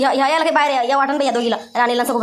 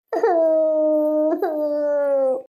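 long acted sob; drawn-out weeping; crying. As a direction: wail wordlessly in grief. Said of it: "नका रडू नका तुम्ही".

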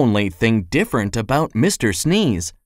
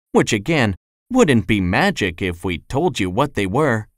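ordinary delivery, nothing marked

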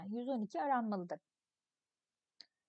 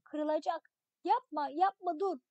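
alarmed cry, pitch up and a little louder; pleading shout; 4 semitones higher, 4 dB louder than usual. Acted. Boy crying to his brother on the branch, alarmed shout. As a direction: beg him to stop before the branch breaks. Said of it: "Bağırıyorum".

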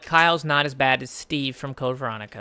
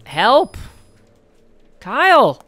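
loud, raised-pitch calls for help; calling out; 4 semitones higher, 9 dB louder, much slower than usual. Real, not acted. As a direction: call out to a partner who isn't answering. Calling game companion for help.

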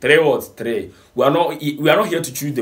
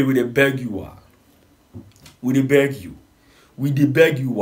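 normal talking voice

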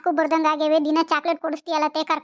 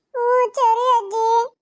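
put-on high, whiny small-boy voice; little grandson; 5 semitones higher, 3 dB louder, much slower than usual. Grandson whining to grandma for chocolate, high and whiny. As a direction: plead like a spoiled little boy.

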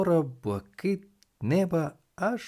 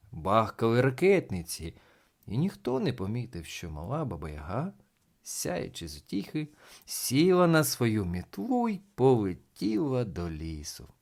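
usual speaking voice

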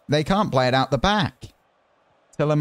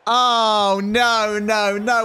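high, loud "oh no" at a goal; panicking as the chance goes in; alarmed shout; 10 semitones higher, 4 dB louder, much slower than usual. Real, not acted. Gamer watching his side concede, alarmed high shout.

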